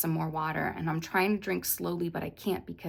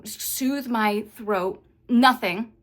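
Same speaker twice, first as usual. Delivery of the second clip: higher and louder, rising panic; panicking on the plane with no gum or candy, higher and louder; freaking out; 5 semitones higher, 6 dB louder than usual. Retold or imitated. Retelling a panic attack on the plane, frantic. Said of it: "like, freaking out".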